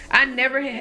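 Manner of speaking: ordinary talk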